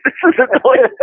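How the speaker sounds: high-pitched, laughing Australian parody; squeaky, laughing put-on voice. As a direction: crack up while doing a mock Aussie. Mock Crocodile Dundee line while laughing.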